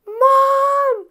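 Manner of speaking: put-on high, loud child's scream, slow on the commands; daughter screaming. Little daughter ordering her arguing parents to their rooms, screaming. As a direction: shriek it like a furious kid bossing her parents. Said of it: "she starts screaming".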